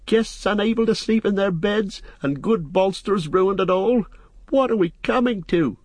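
landlord lamenting in a high voice; high, aghast outcry; horrified cry. A narrator's reading. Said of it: "he cried, raising his hands in horror".

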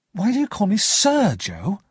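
high, indignant question; angry interruption. A narrator's reading. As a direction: cut in crossly, pitch shooting up. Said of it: "I interrupted him angrily".

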